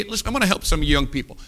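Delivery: ordinary delivery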